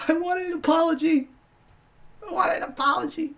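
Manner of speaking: very high, whiny falsetto; squeaky mock-tearful complaint; mock whining. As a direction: whine it like a wounded crybaby, hammed up. Mock pleading for an apology.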